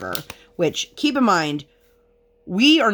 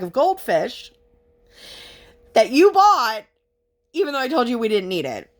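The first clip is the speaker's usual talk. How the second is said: exasperated rant; 4 semitones higher than usual. Retold or imitated. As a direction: vent at mom, climbing higher. Ranting at mom, retold.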